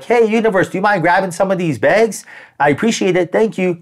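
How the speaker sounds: high-pitched, casual asking voice; polite request. Acted. Asking the universe to grab some bags, politely. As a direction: ask a favour in a lifted, polite voice.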